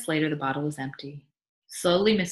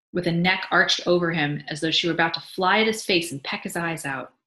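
usual speaking voice

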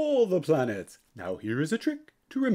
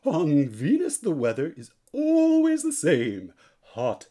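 ordinary delivery

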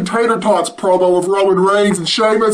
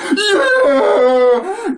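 higher whoop through laughter; laughing cheer; 6 semitones higher than usual. Real, not acted. Vlogger celebrates the ratings news, laughing whoop. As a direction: cheer while cracking up.

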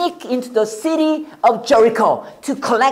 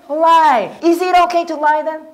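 normal talking voice

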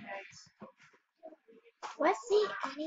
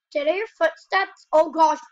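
alarmed; 9 dB louder than usual. Real, not acted. Hears footsteps in game.

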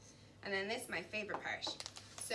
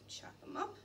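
a soft whispered aside to herself; 7 semitones higher, 6 dB quieter than usual. Real, not acted. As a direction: breathe it out under the blade. Whispered aside while chopping.

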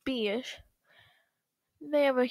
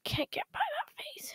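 high, soft whisper; 13 semitones higher, 9 dB quieter than usual. Acted. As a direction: whisper it disgusted. Joke about spider face.

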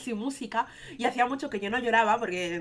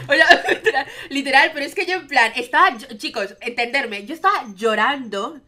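loud, higher words through laughter; laughing; 4 semitones higher, 11 dB louder than usual. Real, not acted. Laughing while telling viewers about her period, loud. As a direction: talk while cracking up.

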